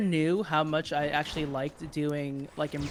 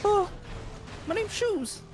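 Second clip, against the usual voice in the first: squeaky high nervous goblin; slower than usual. Acted. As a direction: pitch way up as a timid goblin. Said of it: "So he's just like".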